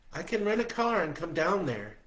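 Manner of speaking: high, loud worried woman; worried Stella. A narrator's reading. Stella worried about his sickness, high and loud.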